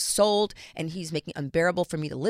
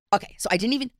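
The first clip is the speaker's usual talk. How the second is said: normal talking voice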